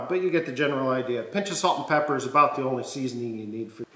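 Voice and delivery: ordinary talk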